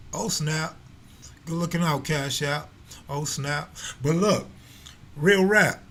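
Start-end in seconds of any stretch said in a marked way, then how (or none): none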